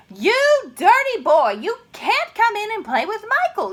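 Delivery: loud high scold breaking into a shocked cry; scold, then gasp in recognition. Acted. Mother yelling at her dirty boy until she recognises him.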